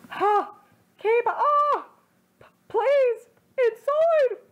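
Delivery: high, loud pleading moans; begging cries. Acted. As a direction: cry out high and desperate. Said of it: "Naruto begged".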